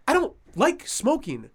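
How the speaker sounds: slow, high, prissy complaint; snooty older man. Retold or imitated. Older man objecting to a cigarette nearby, snooty. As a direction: draw it out like an offended snob.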